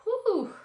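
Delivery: high, loud blown-out whew; exhaled whew. Real, not acted. Speaker steadying herself after admitting regret, big whew. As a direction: blow out a big breath to steady yourself.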